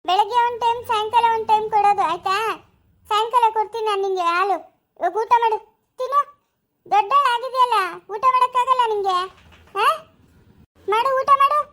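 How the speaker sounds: high, loud, scolding caretaker voice; stern nanny coaxing. Acted. Orphanage caretaker ordering a crying child to eat, high and loud.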